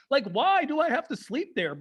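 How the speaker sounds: ordinary talk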